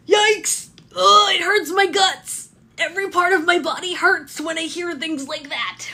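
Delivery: high, loud hammed-up wail of pain; mock agony. Babysitter aghast that the baby turned sixteen, mock agony. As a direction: wail it out in exaggerated anguish.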